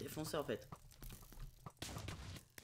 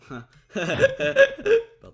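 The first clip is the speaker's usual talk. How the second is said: laughing; 17 dB louder than usual. Real, not acted. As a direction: burst of loud laughter. Laughing at own joke on volcano.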